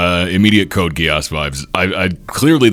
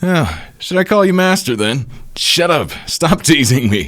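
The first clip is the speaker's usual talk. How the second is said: lightly acted higher-pitched bickering; playful mock exasperation, pitched up; higher, teasing back-and-forth between friends; bickering comic characters; 10 semitones higher, 4 dB louder than usual.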